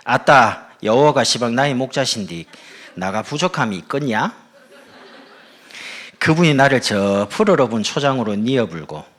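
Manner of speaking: loud Jeolla dialect recital; punchy comic Jeolla accent. Acted. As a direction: launch boldly into broad Jeolla dialect. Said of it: "전라도 버전입니다".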